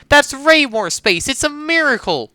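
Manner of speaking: high, drawn-out cry of delight; overjoyed. Acted. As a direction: celebrate a breakthrough, loud and high-pitched. Mock celebration of a breakthrough.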